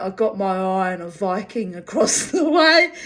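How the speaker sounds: loud, with a laugh breaking in; retold loud announcement. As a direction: announce it boldly, half laughing. Retold brush-off in noisy club.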